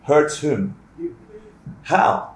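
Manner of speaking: ordinary talk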